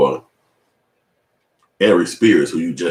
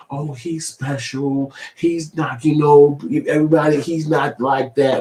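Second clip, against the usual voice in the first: high, slow, patronizing mockery; mocking coworkers who brush off his complaint; condescending coworkers; 7 semitones higher, slower than usual. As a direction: talk down like he's a child. Coworkers talking down to him.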